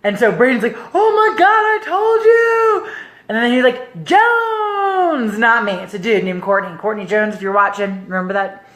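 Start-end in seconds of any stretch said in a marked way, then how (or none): 0.9-2.9 imitated excited cheer. Husband celebrating her raffle win, excited singsong. 4.0-5.4 high, long-held surname call; imitated announcer. Host finishing the winner's name, drawn-out announcer call.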